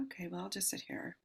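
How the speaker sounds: soft unvoiced whisper to herself; hushed aside while waiting; whispered thought. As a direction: whisper it under your breath. Quoted thought in waiting room.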